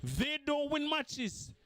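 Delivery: mocking rival fans, slow emphatic repeat; repeated with emphasis. Real, not acted. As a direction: hammer each word home. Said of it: "I repeat".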